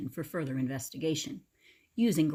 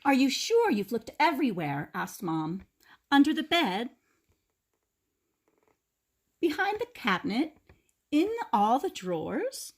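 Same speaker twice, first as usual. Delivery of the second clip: higher, louder questioning mother; mom voice; 7 semitones higher, 5 dB louder than usual. A narrator's reading. Mom quizzes Grandpa about where he searched, higher voice. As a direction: press him gently but urgently.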